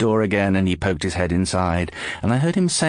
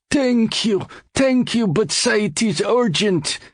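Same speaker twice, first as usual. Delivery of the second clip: put-on much higher, foreign-accented voice, eager and pleading; a nervous little man thanking too much; anxious foreigner; 13 semitones higher than usual. George thanks the doorman, high and anxiously pleading.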